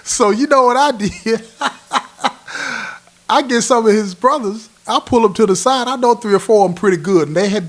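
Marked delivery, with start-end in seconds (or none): 0.0-1.5 laughing. Real, not acted. Gleeful setup of his scheme. 1.5-3.0 a high, breathy burst of real laughter. Real, not acted. Cracking up while telling his scheme, laughing high.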